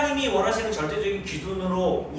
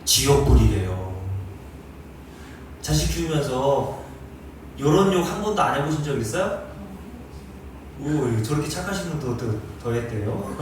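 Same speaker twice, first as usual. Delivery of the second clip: normal talking voice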